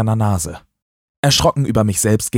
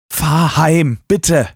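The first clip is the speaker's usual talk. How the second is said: pleading; 7 semitones higher, 4 dB louder, much slower than usual. Acted. Emma begs Taylor to drive home, pleading. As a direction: beg him to just go home. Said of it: "flehte sie".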